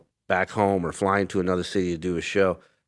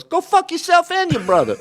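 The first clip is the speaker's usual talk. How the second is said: high-pitched mock outburst; acted cussing-out. Beetlejuice to a fan asking for a hello, high mock outburst. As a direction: fire off the curse in a put-on high voice. Acting B's curse at a fan.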